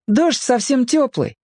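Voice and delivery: put-on very high boy's voice; boy hastening to explain. A narrator's reading. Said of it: "объяснил он поскорее". (мальчик поясняет высоким голосом)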